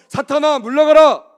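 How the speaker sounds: very loud, high-pitched rebuke of Satan; commanding shout. Shown as a demonstration. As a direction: shout it out for the congregation to repeat. Has congregation shout it at neighbours. (사탄을 쫓듯 크게 외치는 명령)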